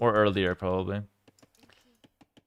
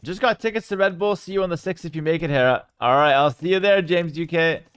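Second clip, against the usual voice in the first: usual speaking voice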